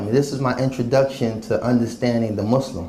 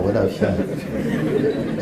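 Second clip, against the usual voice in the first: drawn-out breathy imitation; much slower than usual. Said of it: "That's the Yemenis brother".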